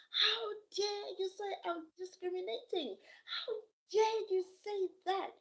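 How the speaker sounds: high, mock-indignant how dare you; retold teacher's indignant outburst; imitating outraged teacher. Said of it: "the teacher went wild".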